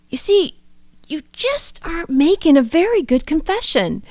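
put-on high, stern voice of an old priest scolding; priest's stern rebuke. Priest scolding her in confession, stern imitation. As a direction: deliver it like a priest calling her out. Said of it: "he said it with such conviction".

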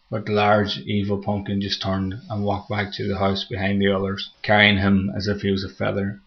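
plain reading voice

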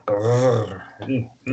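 a loud aroused "Ugh."; turned-on ugh. Retold or imitated. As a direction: let out a hungry, loud groan of desire. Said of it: "And I was like".

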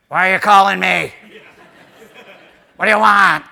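loud, exasperated friend answering the phone; mock irritated shout. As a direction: yell it like an unsympathetic buddy. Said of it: "He'll go".

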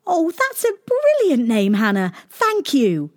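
very high, delighted little princess; cartoon princess. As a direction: gush with thanks like a little girl. Princess thanks Hannah delighted.

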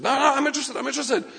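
high-pitched, hurried-in eager insistence; eager protest. Acted. Listener assuring God of their interest, eager protest. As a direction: jump in high and keen to protest.

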